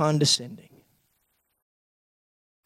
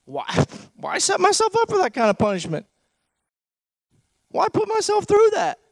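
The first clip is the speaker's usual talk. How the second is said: pleading, rising higher; inner voice of hurt listener; 12 semitones higher than usual. Retold or imitated. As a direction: voice the hurt person's doubts. Voicing the hurt listener's thoughts.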